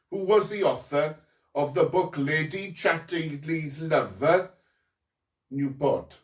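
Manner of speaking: put-on Newport accent on every word. Quizmaster asking about Chatterley author, in a Newport accent. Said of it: "That's my Newport accent".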